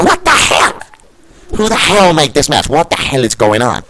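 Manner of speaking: high squawk of outrage; outraged disbelief. Acted. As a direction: protest the booking in shock. Commentator outraged at booking.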